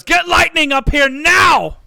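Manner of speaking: urgent high bellow; officer's full-force shout, very high and loud; shouted order. Acted. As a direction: yell a command to the crew.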